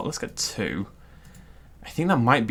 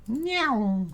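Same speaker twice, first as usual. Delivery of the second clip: high, drawn-out cat meow; playful meow; 11 semitones higher than usual. Pretend. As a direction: meow like a cat, pitched right up. Imitates a cat noise mid-game.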